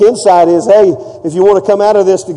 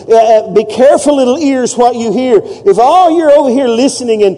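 ordinary talk

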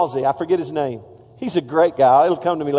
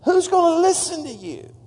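mocking critic; 11 semitones higher than usual. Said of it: "he had all kinds of people".

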